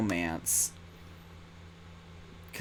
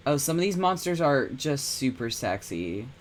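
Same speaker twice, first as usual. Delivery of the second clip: ordinary talk, nothing marked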